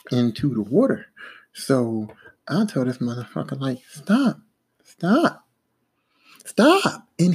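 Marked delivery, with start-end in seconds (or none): 3.9-7.1 shouting stop. Retold or imitated. To coworker moving the barge, rising panicked shouts. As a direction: yell at him to stop before you fall.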